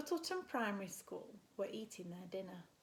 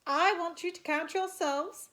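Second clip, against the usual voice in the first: high, loud classroom voice; teacher getting the kids to count; cheery teacher; 6 semitones higher, 9 dB louder than usual. Acted. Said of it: "said the teacher".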